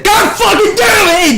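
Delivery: very high, slow, loud Southern yell; all-out hammed-up angry Southern shout; furious shout. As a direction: roar it while kicking a box. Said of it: "I went all out with it".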